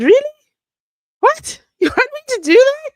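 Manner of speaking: high, loud, laughing disbelief; surprised disbelief. Real, not acted. Reacting to a viewer asking her to read Sunday school, high laughing disbelief. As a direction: squeal it out, amazed anyone asked.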